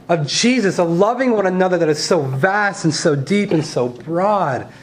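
preaching. Acted. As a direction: preach it with rising passion. Preaching continues.

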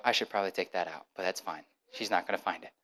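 low, quiet talking to oneself; self-reassurance. Retold or imitated. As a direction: brush a worry off under your breath. Self-talk, reassuring himself while looking down.

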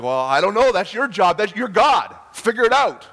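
loud, rising, fed-up comeback; indignant retelling of an argument; exasperated retort. Retold or imitated. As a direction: throw it back at them, building in pitch and volume. Retold exasperated retort to God.

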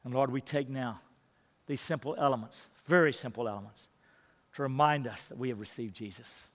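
soft, low reverent prayer. Acted. Pastor praying over communion for the church, hushed and reverent. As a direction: pray quietly over the bread.

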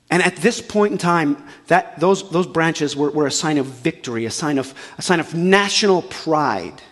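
ordinary talk

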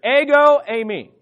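Ego punched loud and high, eimi dropping back; emphatic Greek phrase. Shown as a demonstration. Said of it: "Let's all say that together".

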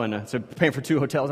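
usual speaking voice

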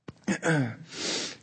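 clears a rough throat; throat clearing. Real, not acted. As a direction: clear the throat before speaking. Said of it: "Forgive me".

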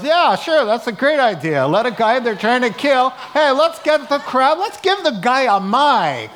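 hammed-up sarcastic Roman officer; sarcastic guard. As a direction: scoff like an incredulous soldier. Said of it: "And the guy goes".

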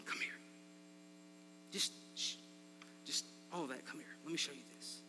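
soft, hushed call with a shh; quiet. Retold or imitated. Elijah quietly calling the people closer, hushed. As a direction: beckon them in gently, almost whispering. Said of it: "I picture him quiet".

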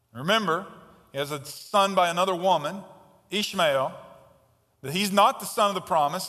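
normal talking voice

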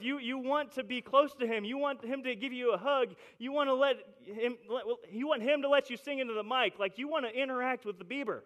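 normal talking voice

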